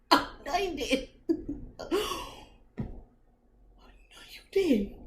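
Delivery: high pitched gasping disbelief; shocked squeal. Retold or imitated. Shocked reaction in bed.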